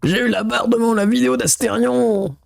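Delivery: high anguished anime voice; Reiner meme voice. Retold or imitated. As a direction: wail it like a tormented anime hero. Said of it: "La fameuse scène de Reiner".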